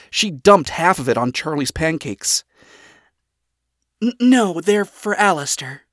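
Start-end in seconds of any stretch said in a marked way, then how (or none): 4.0-5.8 high voice with a stammered start; stumble, then admit it awkwardly; hesitant admission. A narrator's reading. Daughter hesitantly admits it to father.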